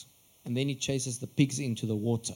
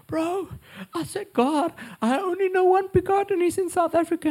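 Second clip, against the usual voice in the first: very high, loud tearful squeal; mock crying; 6 dB louder than usual. Imitating a crying friend on a call, high sobbing voice. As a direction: imitate a man wailing on the phone, pitched right up. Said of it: "he's crying. He's like".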